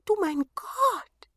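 high, slow, wondering dwarf; amazed dwarf. A narrator's reading. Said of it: "riefen sie".

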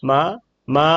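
normal talking voice

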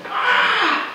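high laughing cry of dismay; a playful exasperated shout; laughing mock yell. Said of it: "You go".

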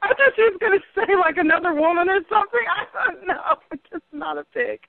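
high, helpless giggling speech; laughing. Real, not acted. Caller reacts to the pig story, laughing. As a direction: squeal it out through laughter. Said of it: "so crazy that you have to laugh".

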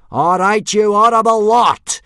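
very high, very loud bellow; full-force roll-call bellow; yell. A narrator's reading. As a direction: yell like a drill sergeant at recruits. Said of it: "yelled Gobber".

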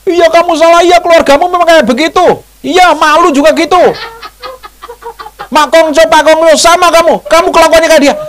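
very high, loud mock quarrel; acted accusing tirade. Quarrelling spouse blaming the other's whole family, hammed-up shrieking tirade. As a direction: pile up accusations at a high-pitched shout. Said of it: "Kesalahannya tuh dibangkit-bangkit".

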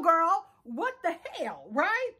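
ordinary talk, nothing marked

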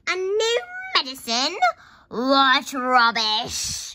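loud, drawn-out scoffing; scornful shout. A narrator's reading. Grandma rejects George's claim, loudly scoffing. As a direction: stretch out the scorn at full volume.

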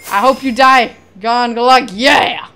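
loud gloating cheer; triumphant shouting. Real, not acted. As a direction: crow over the kill. Winning fight, taunting.

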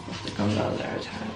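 whispered while concentrating. Real, not acted. To viewers while fishing out the yolk, whispered. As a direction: breathe it out softly, no voice.

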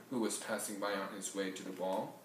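plain reading voice

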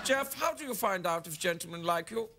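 put-on comic German accent, question to a sidekick; mock German accent. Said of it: "in my German accent".

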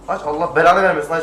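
loud exasperated cursing at the car; frustrated curse. Real, not acted. Gamer cursing his car to turn on the headlights, loud frustrated.

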